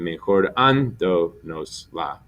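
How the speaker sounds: Mejorando nos la slowly, stressing the AN; stress stays on an. Shown as a demonstration. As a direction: keep AN strongest in Mejorando nos la. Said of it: "you want it to stay the same".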